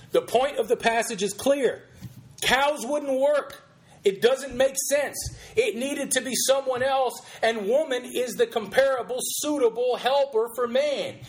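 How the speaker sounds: preacher quote. Acted. Host quoting the preacher's argument on the passage, preacherly. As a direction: build the case like an insistent preacher.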